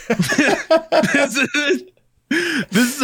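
high, laughing through the words. Real, not acted. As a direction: crack up mid-sentence. Laughing while introducing track.

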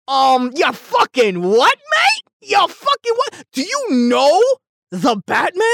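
high, loud incredulous "ya fucking what mate"; rising outraged disbelief; mock outraged rant. Real, not acted. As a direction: pitch shooting up in outrage. Ranting at a critic's review.

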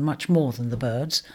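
ordinary delivery

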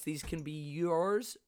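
slow, drawn-out yours; stretching the word yours. Real, not acted. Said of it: "Why did I say it like that?".

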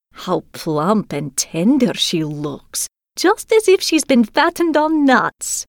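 slow, gloating crone voice that jumps high; old robber woman. Acted. Old robber woman eyes Gerda hungrily, gloating crone voice. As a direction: savour each word like a greedy old hag. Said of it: "cried the old robber woman".

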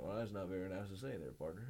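lightly scolding Western voice; Western accent. Retold or imitated. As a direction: play a Western cowboy, partner. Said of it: "like, Western, be like".

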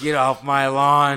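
put-on loud, slow, ranting old man; shouted lawn line. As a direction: bark it at the intruders. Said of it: "Clint can't die like that".

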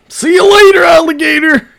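high, loud taunting singsong; mocking singsong. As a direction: taunt them in a sing-song. Taunting the Lakers.

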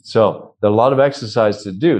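ordinary talk

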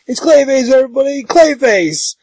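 high mock shout to a crowd; acted alarm call. Bill exposing Clay to the party, acted alarm call. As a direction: raise the alarm, pitched well up.

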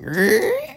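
high-pitched door creak; creaking door noise. Retold or imitated. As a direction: imitate a door creaking open. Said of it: "like, the opening of the door".

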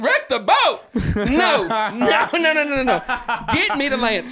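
high, loud laughing no-no-no; half-laughing frantic refusal; laughing panic. Retold or imitated. Panicked reply to friend.

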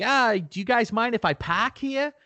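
high-pitched, exaggerated Boston accent; thick Boston accent. Driver asks his pals about parking, thick Boston accent. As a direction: drop the r's in a broad Boston accent. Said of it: "like, a thick Boston accent".

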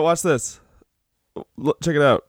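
normal talking voice

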